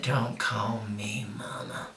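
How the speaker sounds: slow, hushed low warning; menacing whisper. Retold or imitated. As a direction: whisper it slowly as a threat to your child. Mother's quoted warning to child.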